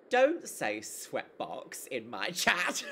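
slow, drawn-out mock telling-off, laughing; mock scolding. Streamer tells off the chat, mock scolding. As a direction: scold them with a laugh underneath.

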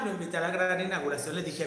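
normal talking voice

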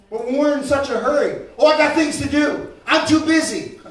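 loud, high, driving sermon shout; preaching at full force. Acted. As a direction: preach it out to the whole congregation. Preacher mocks busy people.

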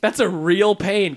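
slow, loud, pained complaint; exasperated groan. Real, not acted. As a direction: drag it out in exasperation. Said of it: "groaning at the sad twist".

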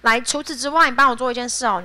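ordinary talk, nothing marked

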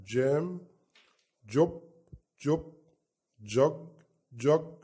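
each word said slowly and clearly twice; teacher drill. Shown as a demonstration. Teacher drilling J words for kids, clear and slow. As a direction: model words for children to repeat. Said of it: "على سبيل المثال".

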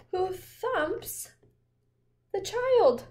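high and loud; excited. Pretend. Reading the chaos to the child, high and loud. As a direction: punch it with alarm.

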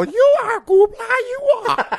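very high squeaky voice; tiny helper flattering master, squeaky high; little creature. Retold or imitated. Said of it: "the little thing will go".

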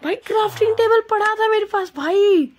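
very high, loud cry of disbelief; exasperated shout. Real, not acted. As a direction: wail at your own silly mistake. Discovers table was there all along.

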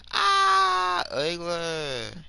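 squeaky high yell then a loud ugly; mock shout. Yelling ugly at a rival gamer, high and loud. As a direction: screech it at the other player.

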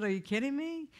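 retold reaction to being asked for money; incredulous. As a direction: ask it as if the request is absurd. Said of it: "I said".

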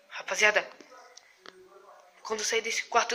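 ordinary delivery

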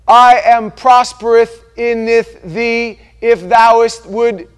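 loud, high, slow scripture declamation; mock King James. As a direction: intone fake archaic scripture with pompous weight. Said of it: "He didn't speak like that".

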